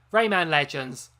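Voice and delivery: said loudly and proudly. Real, not acted. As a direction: belt out the name. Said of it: "I have to say that loudly".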